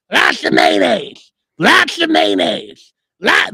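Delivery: put-on frail old lady voice. At Subway, the lady in the wheelchair insists, croaking slowly. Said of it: "this is exactly how she sounded".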